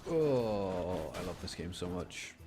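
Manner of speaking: ordinary talk, nothing marked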